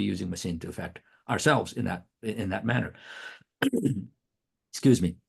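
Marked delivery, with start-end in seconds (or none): none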